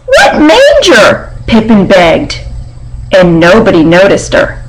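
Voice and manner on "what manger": high, slow plea in a small piglet voice. Acted. Piglet begging the barn animals to explain, high and pleading. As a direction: stretch the question out like a left-out little pig.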